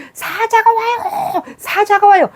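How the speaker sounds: high, urgent alarm call with a nervous laugh; frantic warning cry. Acted. A lookout warning the congregation of a charging lion, frantic high cry. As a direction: shout the warning like a lion is right behind you. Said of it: "빨리 신호를 해줘야 되잖아".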